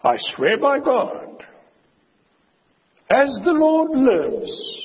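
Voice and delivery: high, slow, loud pompous oath-swearing; mock solemn oath. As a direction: swear it grandly like a false prophet. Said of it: "swearing false oaths".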